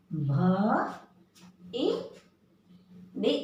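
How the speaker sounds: each letter spelled out slowly with long pauses, short इ jerked; syllable drill. Shown as a demonstration. Teacher drilling the class, spelling syllables slowly. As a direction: spell out क, इ, कि one beat at a time. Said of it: "इसी तरह बच्चों".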